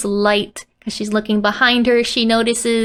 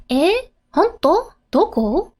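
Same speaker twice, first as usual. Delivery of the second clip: surprised; 5 semitones higher than usual. Acted. Excited reply.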